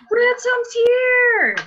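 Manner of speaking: high, loud, drawn-out squeal of news; excited cry. Real, not acted. Host thinking Ransom has arrived, excited high shout. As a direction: shriek it slowly with delight.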